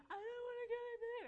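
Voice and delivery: put-on high, whiny wail of a crying toddler. Mom imitating her crying toddler's high wail. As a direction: squeal it like a sobbing two-year-old. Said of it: "He just kept crying".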